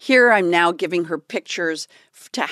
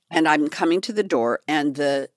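ordinary delivery

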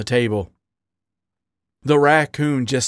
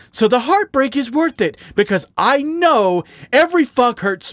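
higher-pitched, impassioned outpouring; anguished confession; 10 semitones higher, 4 dB louder than usual. Acted. Raccoon confessing painful love to his partner, anguished. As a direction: pour out hurt and longing. Lovers' quarrel, bitter confession.

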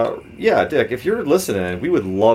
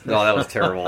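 ordinary talk

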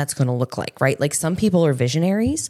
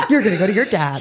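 laughing; 5 semitones higher, much faster than usual. Real, not acted. Laughing while she responds.

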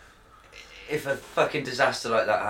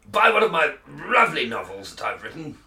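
higher, louder plummy politician plugging his book; Boris Johnson voice; 5 semitones higher, 4 dB louder than usual. Acted. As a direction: sell it like a pompous toff. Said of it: "Boris is at the helm".

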